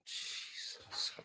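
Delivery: breathless whispered plea; real whispered despair. Real, not acted. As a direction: gasp it in despair.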